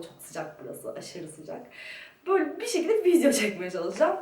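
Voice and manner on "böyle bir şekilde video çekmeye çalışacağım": echoing room. Real, not acted. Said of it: "Ses yankı yapıyor".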